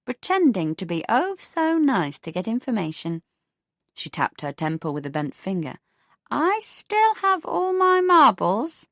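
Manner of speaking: put-on a prim elderly neighbour; prim older neighbour. Beatrice indignant.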